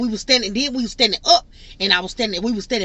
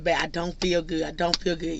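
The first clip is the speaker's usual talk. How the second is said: feeling sick; 4 semitones lower, 6 dB quieter than usual. Retold or imitated. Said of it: "I said".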